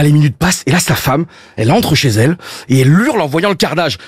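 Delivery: ordinary talk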